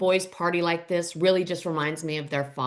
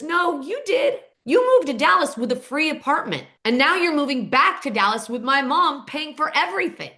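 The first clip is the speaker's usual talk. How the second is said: higher-pitched retold comeback; retorting; 5 semitones higher than usual. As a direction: hit right back in a mock argument. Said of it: "Cole comes right back at her".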